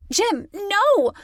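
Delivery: a pained, alarmed cry; yelp. A narrator's reading. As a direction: yelp it in sudden pain. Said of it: "I yelped".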